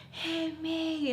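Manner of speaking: high, drawn-out mock scream at soft volume; retold screaming. Two friends screaming at each other, soft mock scream. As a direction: imitate a friend's scream, held back. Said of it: "just kept screaming at each other".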